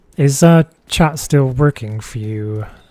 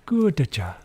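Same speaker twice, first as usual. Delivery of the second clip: put-on catchphrase, said twice with drawn-out words; lightly voiced PR coach praise; imitating the PR coach; 2 semitones higher, much slower than usual. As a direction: mimic the coach's stock praise from the film. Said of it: "the guy that's trying to teach him how to do public relations".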